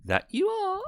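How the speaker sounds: very high falling reply; tiny cartoon voice; very high squeak. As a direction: squeak the answer.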